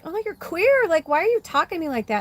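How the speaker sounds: high, fast, sneering sister voice; mock her like a scornful sister.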